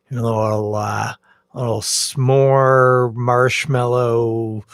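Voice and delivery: slow, drawn-out teasing; mocking. Host teasing lovers of novelty beers, slow mocking list. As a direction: stretch each beer name out slowly, mockingly.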